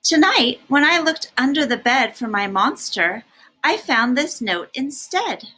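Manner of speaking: bright read-aloud storytelling; story reading. A narrator's reading. Parent reading a picture book to a child, lively storytelling.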